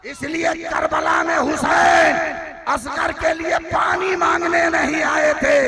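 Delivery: high, loud, slowed preaching shout; fiery proclamation. Acted. Preacher shouting Asgar's vow to the crowd. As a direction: thunder the child's vow. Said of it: "कहा".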